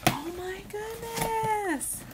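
high, slow, soft coo; awed delight. Real, not acted. Unboxer gasping at what is inside her gift, awed delight. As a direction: draw it out softly up high in wonder. Gasps on seeing box contents.

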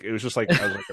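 normal talking voice

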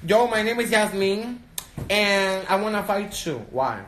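put-on loud, brash girl picking a fight; tough school girl voice. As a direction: play the girl itching for a fight. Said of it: "I look like that one bitch at school that wanna fight everybody".

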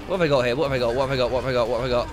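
low-pitched rattled-off chant; low hurried repeating. Real, not acted. Player searching weapons mid-fight, low hurried repeating. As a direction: repeat it under pressure, low and monotone.

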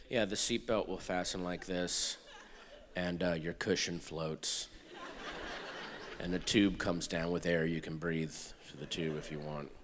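very low, soft, lifeless monotone; deadpan stewardess. Acted. Stewardess giving the live safety demonstration, deadpan and low. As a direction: drone it out with a dead face. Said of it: "See how dead my face is".

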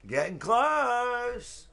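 very slow, high stretched words; long, high drawl; drawn-out teasing. Real, not acted. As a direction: stretch it right out, pitched high. Repeats remark to Straw, stretched out.

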